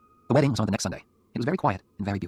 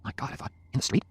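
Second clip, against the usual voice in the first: ordinary delivery, nothing marked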